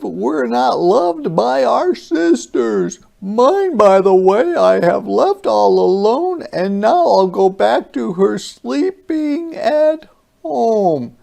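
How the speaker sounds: high, loud creature voice, turning wistful; lonely alien thinking of his sister; monster softening. Acted. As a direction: let the giant's voice soften toward tears.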